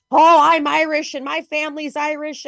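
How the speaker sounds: scoffing imitation of newly proud Irish-Americans; mocking proud Irish-Americans. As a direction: parody someone bragging they're Irish. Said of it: "people coming out and being like".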